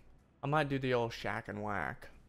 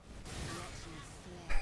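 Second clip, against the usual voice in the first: 1 breathy laugh; 6 semitones higher, 5 dB quieter than usual. Real, not acted. Laughing at the game.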